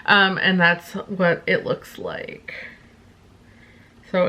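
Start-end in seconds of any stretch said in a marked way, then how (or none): none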